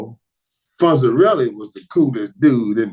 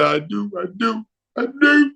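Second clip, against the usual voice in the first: each I do climbing much higher; mock wedding vow; 10 semitones higher than usual. Fan back at the altar, mock vow climbing high. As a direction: vow it again, lightly mocking. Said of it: "just say".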